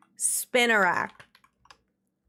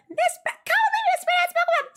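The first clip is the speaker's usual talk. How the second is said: very high-pitched mocking imitation. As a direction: squeal it, mocking a stupid ad. Mimicking a stupid ad.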